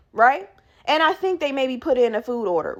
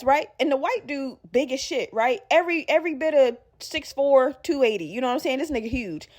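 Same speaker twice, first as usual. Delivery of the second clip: normal talking voice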